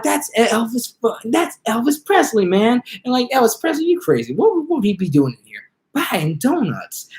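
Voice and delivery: gawk at him in disbelief; starstruck donut clerk. Retold or imitated. Donut clerk gawking at [name], high disbelieving voice. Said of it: "goes like, you know".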